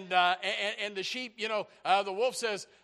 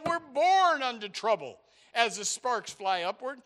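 raised, loud preacher's voice; impassioned preaching; 7 semitones higher, 8 dB louder than usual. Real, not acted. Preacher telling congregation that trouble chases them, impassioned preaching. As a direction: press it home with high, punchy emphasis.

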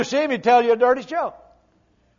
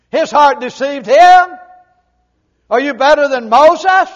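ordinary talk, nothing marked

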